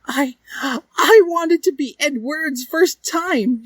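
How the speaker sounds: high, slow sobbing confession; crying. A narrator's reading. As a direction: blubber it through fake tears. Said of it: "She started crying".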